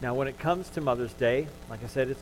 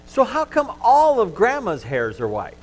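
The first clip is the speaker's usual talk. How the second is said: put-on little girl; 9 semitones higher, 8 dB louder than usual. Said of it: "The little girl thinks about this".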